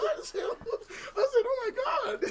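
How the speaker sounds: very high, drawn-out laughing wail; hammed-up shock. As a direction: re-enact shock in a loud, laughing squeal. Retelling his shock, cracking up.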